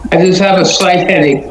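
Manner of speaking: ordinary talk, nothing marked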